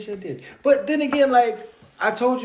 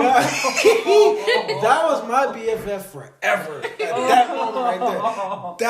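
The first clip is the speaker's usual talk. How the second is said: laughing through the words, louder; can't stop laughing as he tells it; 6 semitones higher, 10 dB louder, much slower than usual. Real, not acted. Laughing while telling the story.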